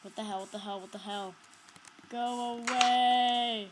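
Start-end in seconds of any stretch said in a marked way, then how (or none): 2.1-3.7 loud, slow drawn-out yell; long, loud go away; shout. Real, not acted. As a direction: scream it at the monster. Yelling at monster.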